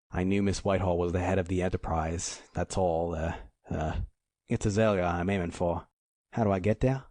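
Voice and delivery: plain reading voice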